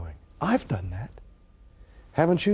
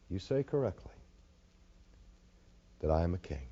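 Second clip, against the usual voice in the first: hushed reading; 7 semitones lower, 7 dB quieter, faster than usual. Acted. Quiet reading of Jesus' answer.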